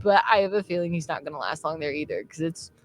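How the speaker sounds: ordinary delivery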